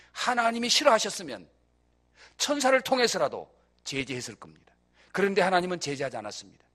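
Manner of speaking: normal talking voice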